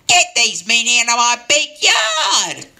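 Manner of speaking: high-pitched mock-furious scream; hammed-up, very high angry shriek; furious old lady. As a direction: screech the order like an outraged old lady. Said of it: "This lady was furious".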